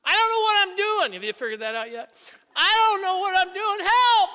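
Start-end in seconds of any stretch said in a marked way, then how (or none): 0.0-1.1 high, loud desperate pleading; overwhelmed priest crying out to God; frantic prayer. Retold or imitated. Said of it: "I've said that a lot to God". 2.5-4.3 high, loud desperate pleading; overwhelmed priest crying out to God; frantic prayer. Retold or imitated. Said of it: "I've said that a lot to God".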